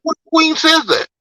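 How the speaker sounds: high, mocking rhetorical question; high scoffing retort; incredulous scoff. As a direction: throw the question out in disbelief.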